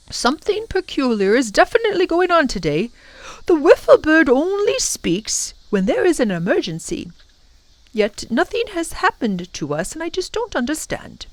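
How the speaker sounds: high-pitched, puzzled professor voice; worried professor. Acted. Professor puzzles over the danger.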